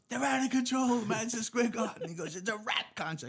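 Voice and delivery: high, loud, frantic yelling; a cop yelling the news in the middle of a riot; frantic cop shout. Retold or imitated. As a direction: shout it like a cop caught in the chaos.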